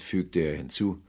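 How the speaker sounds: ordinary talk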